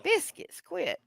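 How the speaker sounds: high, soft scolding of a pet; scolding a cat. Real, not acted. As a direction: tell the kitty off gently.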